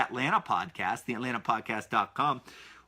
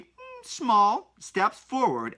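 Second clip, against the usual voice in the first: normal talking voice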